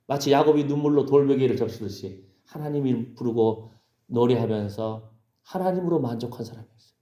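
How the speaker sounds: normal talking voice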